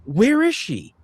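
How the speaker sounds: retold panicked scream. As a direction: imitate someone crying out in shock, pitch jumping up. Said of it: "like, she screamed".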